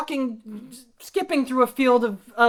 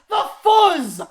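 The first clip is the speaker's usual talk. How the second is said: shout; 4 semitones higher, 4 dB louder than usual. Acted. Spots police coming, calls out.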